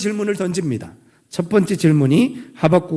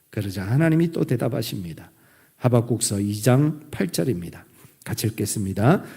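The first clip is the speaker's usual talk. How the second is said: normal talking voice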